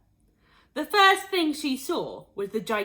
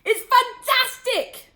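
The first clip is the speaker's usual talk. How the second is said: much higher, slow and loud; ecstatic shout; 10 dB louder, slower than usual. A narrator's reading. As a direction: shout it with wild joy. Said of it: "Mister Cranky shouted".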